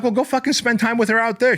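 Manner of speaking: high, incredulous retort; exasperated comeback. Retold or imitated. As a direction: throw it back at her in disbelief. Snapping back at girlfriend.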